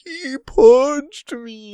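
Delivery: high, loud, drawn-out mock wail; mock wounded Kaido. Co-host mocking Kaido knocked down by Luffy, high wailing. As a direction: wail it slowly like a hurt giant. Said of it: "And Kaido's like".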